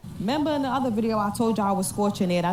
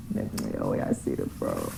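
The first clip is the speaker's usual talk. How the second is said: hushed whisper; 5 dB quieter than usual. Real, not acted. Hiding under covers, filming.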